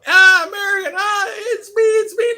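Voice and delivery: high, loud falsetto cries of it's me; imitated woman calling out. Women at the arrival walkway holding up a sign, high loud cries. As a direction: cry out like an eager stranger claiming him.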